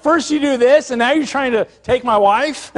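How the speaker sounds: high, loud outraged retelling; upset outburst. Retold or imitated. The king catches Haman on the queen's bed, outraged. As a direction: burst out in mock outrage at the betrayal. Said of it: "And he's so upset".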